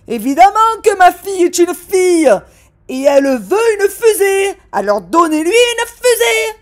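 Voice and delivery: furious father shouting; shouting dad. Acted. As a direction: shout it at the waitress. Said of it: "Papa s'est mis à crier".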